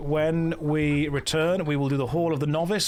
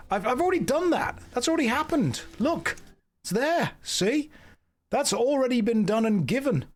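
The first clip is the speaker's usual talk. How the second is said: much higher, whiny protest to chat; defensive protest; 8 semitones higher than usual. Real, not acted. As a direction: plead innocence in a high indignant voice.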